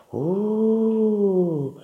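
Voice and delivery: long high "ooh" like wind; howling wind. A narrator's reading. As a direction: imitate the wind at night. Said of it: "ascoltava il vento". (ululato lungo del vento)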